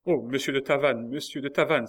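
high, loud pleading call; cry out. A narrator's reading. Marguerite calls to Tavannes passing by, loud and pleading. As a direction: call him back before he leaves. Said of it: "s'écria Marguerite".

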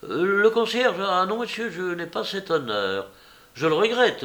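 high-pitched, amused denial; smiling reply. A narrator's reading. Bengali answers the candid stranger who took him for concierge, smiling. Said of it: "il répondit en souriant".

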